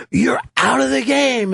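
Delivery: high mock umpire's ejection call; crazed umpire. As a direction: imitate an umpire throwing someone out, pitch up. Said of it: "the ump just goes fucking crazy".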